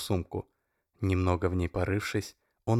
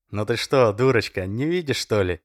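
louder, talking down to her; condescending; 3 semitones higher, 7 dB louder than usual. A narrator's reading. Oleg mocks Masha as a fool, loud and condescending. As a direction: patronise her like a child. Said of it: "снисходительно добавил он".

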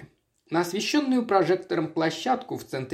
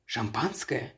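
soft, lower murmur; quiet offer; 4 semitones lower, 7 dB quieter, slower than usual. A narrator's reading. Waiter moves noiselessly round the table offering champagne, softly. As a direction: murmur it discreetly. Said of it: "с тихим".

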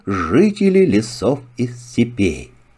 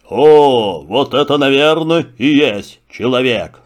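lion voice. Acted. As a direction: speak as a calm king of beasts. Lion speaks. (голос льва)

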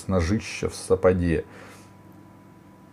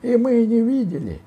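very high, loud mocking chime-in; хор разбойников; 5 dB louder than usual. A narrator's reading. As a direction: shout it high like a gang joining in. Разбойники хором отпираются. (громко, тонким голосом)

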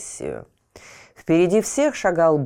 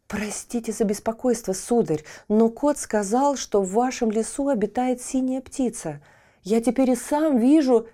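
put-on polite boy; 7 semitones higher than usual.

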